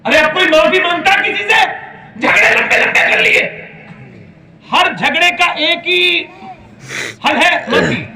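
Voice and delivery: high, loud impassioned sermon shout; preaching at full force. Acted. Preacher roaring to the congregation about Umar. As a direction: cry it out to the crowd.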